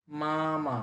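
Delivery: both মা held long and level; মামা with both syllables drawn out flat; Mandarin first tone. Shown as a demonstration. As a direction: stretch মামা on one flat pitch. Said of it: "first tone দুইটাই টেনে বলতে হবে".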